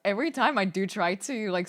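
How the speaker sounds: normal talking voice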